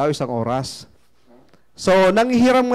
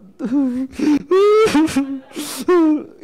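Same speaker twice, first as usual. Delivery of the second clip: mock crying wail; 7 semitones higher than usual. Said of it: "iyak pa rin".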